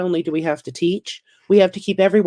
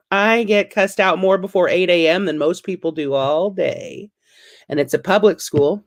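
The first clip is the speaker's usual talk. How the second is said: ordinary delivery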